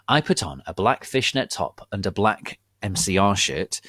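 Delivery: plain reading voice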